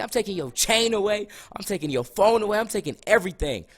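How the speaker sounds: high, loud imitation of mom's tirade; cussing out. Mom taking her son's things away, cussing him out. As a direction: rattle off punishments like an enraged mom. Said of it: "She's cussing me out".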